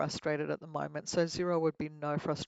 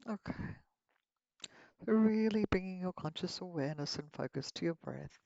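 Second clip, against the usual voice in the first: guided meditation; 6 dB quieter than usual. Acted. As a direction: lead them gently into relaxation.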